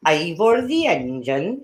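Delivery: slow, drawn-out Welsh lilt; Welsh accent. Shown as a demonstration. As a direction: stretch it out in a sing-song Welsh accent. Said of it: "they talk like it's quite drawn out".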